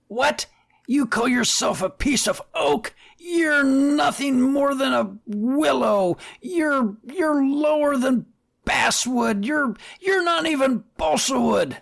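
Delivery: high-pitched hammed-up tirade of insults; mock yelling fit. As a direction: yell insults in a high, over-the-top mock rage. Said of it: "stomping up and down and yelling".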